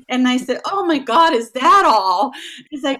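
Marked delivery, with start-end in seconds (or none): 0.7-2.3 loud high exclamation; relieved disbelief. Retold or imitated. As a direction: burst out in relief. Relieved reaction retold.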